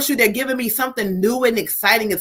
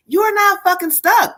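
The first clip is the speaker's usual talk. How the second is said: shouted, high, forceful insistence; 9 semitones higher than usual. Acted. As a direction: push it out like a fired-up coach. Spirit message, emphatic repetition.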